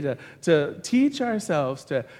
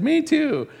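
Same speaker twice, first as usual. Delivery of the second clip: eager delight; 11 semitones higher, 5 dB louder than usual. Pretend. Joke teller matching every denomination.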